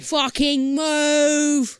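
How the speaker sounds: slow drawn bad British accent. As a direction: ham up a terrible British accent. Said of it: "worst British accent ever".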